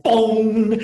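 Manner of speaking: a big shouted boom sound effect; loud boom. Acted. Teacher acting out the frying pan hit with a shouted boom. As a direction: slam out the impact like a cartoon hit.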